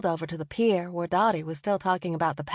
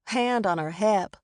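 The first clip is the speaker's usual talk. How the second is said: ordinary delivery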